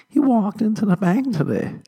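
very high, hammed-up disbelief; incredulous. Woman in crowd keeps repeating his words, mock incredulous. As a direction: mimic the astonished heckler way up high. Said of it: "just repeating stuff, like, incredulously".

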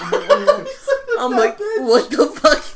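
high, loud retold outburst through laughter; laughing disbelief. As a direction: retell your disbelief while cracking up. Retelling a story through laughter.

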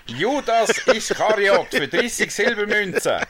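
high, loud, slow proclamation; drawn-out mock denunciation, pitched high; mock accusation. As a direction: declaim it in hammed-up outrage.

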